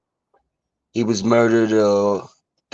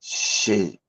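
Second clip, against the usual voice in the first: holding back tears; 3 semitones lower, 5 dB quieter than usual. Real, not acted. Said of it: "I'm trying not to cry".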